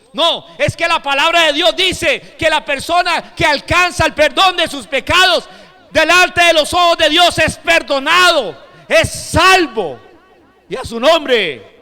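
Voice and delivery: loud, high-pitched pulpit shout; full-force preaching. Real, not acted. Preacher telling the congregation the Spirit convicts of sin, shouted preaching. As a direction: drive each phrase home at full volume.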